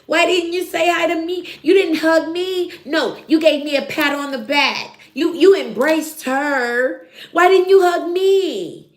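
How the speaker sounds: hammed-up clingy begging for attention; whiny needy Britt. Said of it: "Britt was like".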